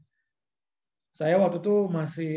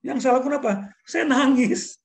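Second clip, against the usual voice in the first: much higher and louder punchline; dramatic reveal; 9 semitones higher, 6 dB louder than usual. Retold or imitated. Telling the host how anger became tears, dramatic high loud reveal. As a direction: build to the reveal and land it. Climax of story, reveals crying.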